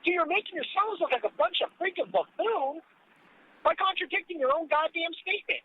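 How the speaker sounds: high-pitched, real exasperation; exasperated. Real, not acted. Co-host calling out the accusers as buffoons, exasperated. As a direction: argue it up high, fed up with the hypocrisy. Indignant rebuttal in political argument.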